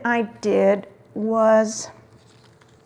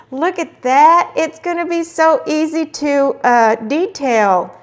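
delighted and louder, slowing on the last word; excited; 6 semitones higher, 6 dB louder than usual. Real, not acted. Crafter marveling at her husband's stencil, excited. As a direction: show off the stencil with real delight.

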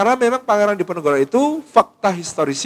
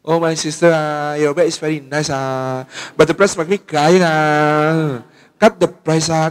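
put-on Chinese-accented English with a drawn-out "ah" at each phrase end; slower than usual. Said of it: "bahasa Inggris yang sangat Chinese". (logat Inggris ala orang Cina yang dibuat-buat, tiap kalimat ditutup "ah")

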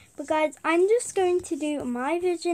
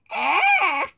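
short excited shout; excited squeal; 3 semitones higher, 6 dB louder than usual. Shown as a demonstration. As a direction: let out a quick excited ah. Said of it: "talking fast because I normally do that".

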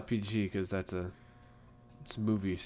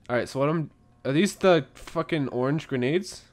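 ordinary delivery

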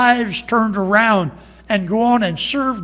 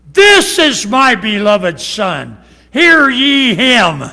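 slow, loud booming proclamation; voice from heaven; 5 semitones higher, 8 dB louder, slower than usual. Acted. God speaking from heaven over the mountain, slow booming shout.

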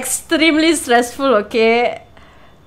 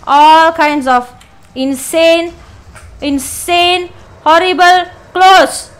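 climbing higher and louder; rising panic; 5 semitones higher, 5 dB louder, slower than usual. Real, not acted. Growing hysteria. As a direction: player building up panic.

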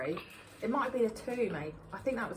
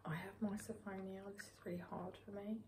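whispering; 14 dB quieter than usual. Real, not acted. Whispered aside while others eat.

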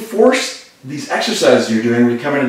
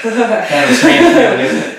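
laughing; 8 semitones higher, 4 dB louder than usual. Real, not acted. Laughing during odd treatment.

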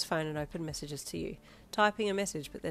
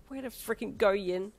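sarcastic; 3 semitones higher, 3 dB louder than usual. A narrator's reading. Sarcastic self-scolding.

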